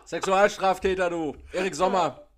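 loud, high brash farewell; mock shouting goodbye. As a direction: bellow it like a neighbour.

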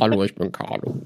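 low, soft Batman-style growl; disguised deep voice. Pretend. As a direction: drop the voice like Batman. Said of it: "Wie Batman".

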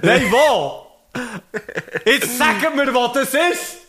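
very high, hammed-up demand; mock shouting. Teasing the co-host about his chant, high mock shouting. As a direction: yell it playfully as the absurd end of a chant. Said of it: "du hast immer gesagt".

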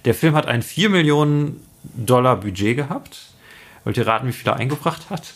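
normal talking voice

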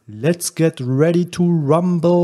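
drawn-out ring announcer call; Michael Buffer. Shown as a demonstration. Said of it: "ich mach das natürlich nicht so geil wie der Michael Buffer".

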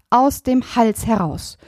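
usual speaking voice

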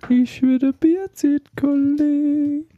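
high squeaky slow announcement; falsetto. Pretend. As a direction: sing it out in a silly falsetto. Silly high voice announcing beer time.

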